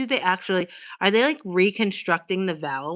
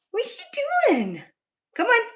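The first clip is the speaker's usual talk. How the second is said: high cat voice. Real, not acted. Calling to cat at window.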